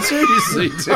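high laughing yell; laughing shout. Real, not acted. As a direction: burst out laughing at him. Laughing at his friend.

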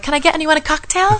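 high-pitched hostess offer; mock-innocent high question; chirpy offer. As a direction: offer drinks in a high, eager voice. Acting out offering drinks at dinner.

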